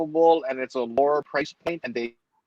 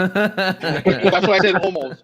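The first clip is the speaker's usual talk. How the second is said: "almost" drawn out loud and high through a laugh; slow down and punch "almost", laughing; loud, laughing emphasis on "almost"; stress on ALMOST; 5 semitones higher, 6 dB louder, slower than usual. Real, not acted. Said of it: "emphasize the word almost".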